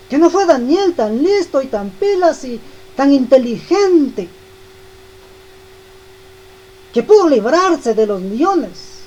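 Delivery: fervent preaching. Acted. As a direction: preach it with mounting conviction. Preaching the point at full force.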